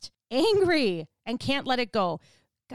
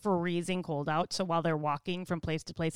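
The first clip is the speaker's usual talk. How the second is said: ordinary talk, nothing marked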